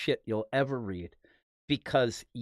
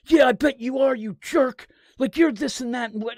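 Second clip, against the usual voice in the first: mock angry retort; 7 semitones higher, 5 dB louder than usual. Retelling author's angry reply.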